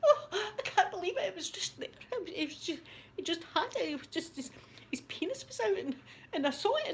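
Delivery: high, breathless mock distress; hammed-up swooning, voice trailing soft; faint with shock. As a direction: act out a fainting woman, very high. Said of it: "she's sitting in a chair fainting".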